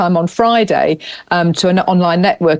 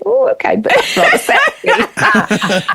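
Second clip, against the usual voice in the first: slow, amused and a bit higher; amused purr; 4 semitones higher, slower than usual. Retold or imitated. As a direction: savour it with a laugh. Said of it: "I'm going".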